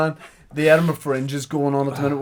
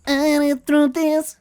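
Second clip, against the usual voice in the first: impression; 3 dB louder than usual. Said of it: "that's not the impression".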